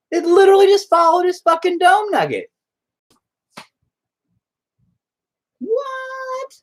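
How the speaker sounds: real astonishment at the brush; amazed disbelief. Real, not acted. Painter shocked the paint followed the head, squealing high.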